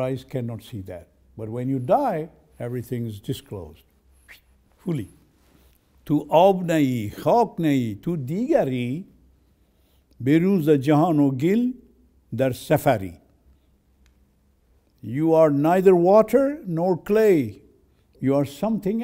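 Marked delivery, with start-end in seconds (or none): 6.0-13.1 fast, loud chanted Persian verse; Persian poem recital. Acted. Teacher reciting Rumi to his audience, loud and fast. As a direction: declaim the Rumi lines with rhythm.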